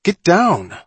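high, loud yelled order. A narrator's reading. Johnny to Mullen up on the rocks, yelling. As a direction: yell it up at someone climbing. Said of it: "Johnny yelled".

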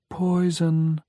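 high, hollow ghostly voice; a spectral child warning; eerie warning. A narrator's reading. Tony repeats warning.

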